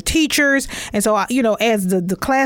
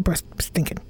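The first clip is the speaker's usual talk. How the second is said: breathy whisper, softer, under her breath; whispered aside; 6 semitones lower, 8 dB quieter than usual. Real, not acted. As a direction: let it slip as a quick embarrassed whisper. Embarrassed aside about bad breath.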